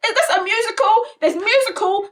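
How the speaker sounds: high-pitched retold disbelief; mock outrage. Recounting own reaction to the film's singing, mock outraged.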